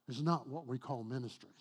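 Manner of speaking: ordinary delivery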